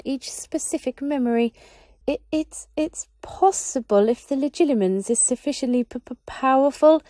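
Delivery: high, stammering and scared; frightened small wizard; nervous stammer. Acted. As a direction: stumble over the words in fear of the master. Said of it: "The small wizard looked more nervous".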